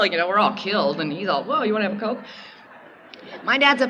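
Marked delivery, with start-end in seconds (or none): 1.4-2.2 imitating dad. Dad voiced calm after fit.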